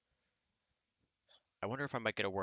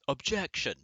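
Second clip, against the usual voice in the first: mock courtroom yell, a bit louder and higher; yelled objection; 5 semitones higher, 4 dB louder than usual. Someone in the plane bathroom hears a yell, mock courtroom shout. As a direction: shout it like a lawyer through the wall. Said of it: "someone in the other room yell".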